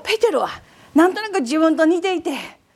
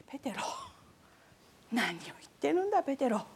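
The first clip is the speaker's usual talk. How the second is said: soft rebuke; 11 dB quieter, faster than usual. Retold or imitated. Jesus gently correcting Peter.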